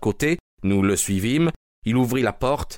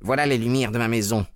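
usual speaking voice

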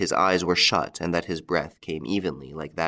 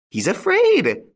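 high, loud triumphant cry, falling; gleeful taunt; 6 dB louder, slower than usual. A narrator's reading. Widow crowing over Lupin's fear, taunting loud. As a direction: crow it out in cruel delight.

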